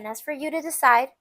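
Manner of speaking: usual speaking voice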